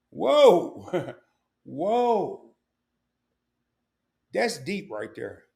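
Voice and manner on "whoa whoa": laughing, drawn-out whoa; amazed whoa. Real, not acted.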